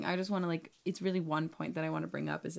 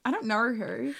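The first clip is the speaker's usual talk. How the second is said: mock Australian; 4 semitones higher than usual. Laughing while mocking an Australian accent with a friend. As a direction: put on a jokey Aussie accent. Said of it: "a roast of Australian accents".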